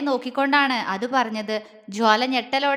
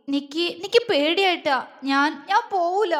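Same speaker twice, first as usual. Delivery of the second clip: higher, halting tearful refusal; scared, whimpering girl; sobbing plea; 4 semitones higher than usual. A narrator's reading. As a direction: plead through small sobs, repeating words. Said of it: "അവൾ തേങ്ങലോടെ വീണ്ടും പറഞ്ഞു".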